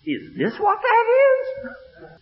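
high, loud, laughing disbelief; stunned layman asks the preacher; amazed. Retold or imitated. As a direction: ask it half-laughing in wonder.

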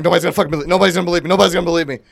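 mock alarm, building the same thought over and over; retold disbelief. Retold alarmed thought, looking around.

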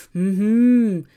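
loud moaning hum; a pained groan through closed lips. A narrator's reading. Trapp holding his groin, groaning. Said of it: "utstöter små stönande ljud".

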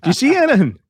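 mock questioning. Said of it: "they do this".

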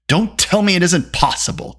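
high, irritated retort; snapped. A narrator's reading. Dolly to doubting Taryn, snapping. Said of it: "Dolly snapped".

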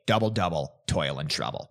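low, quiet witchy chant; witch voice. Retold or imitated. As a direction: intone it like a witch over a cauldron. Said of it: "they start by saying".

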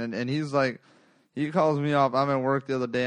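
ordinary talk

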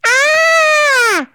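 high, loud comic shriek; squealing sprite scream; silly girly scream. A narrator's reading. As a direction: scream in silly girlish fright. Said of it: "screamed the tree sprite, in a silly girly sort of way".

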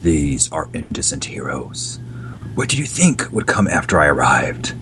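low hushed threat; menacing whisper. Acted. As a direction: whisper it like a hidden avenger. Mystery hero whispers threat.

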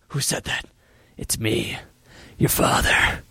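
hushed, menacing Goblin whisper; creepy whisper. Retold or imitated. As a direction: whisper it like a voice in the mirror. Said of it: "Even the scene where it's like".

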